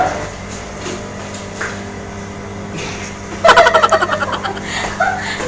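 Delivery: breathy rising giggle; can't stop giggling; giggling laugh. Real, not acted. Laughing at the spoon. (natatawang hagikgik)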